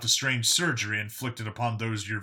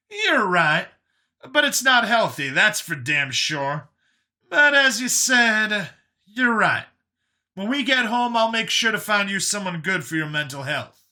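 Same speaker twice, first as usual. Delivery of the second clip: high, loud character voice, conceding; emphatic Brian; 9 semitones higher, 5 dB louder than usual. A narrator's reading. Brian grants Vincent's point but insists on help, emphatic. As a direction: concede loudly and high, with conviction.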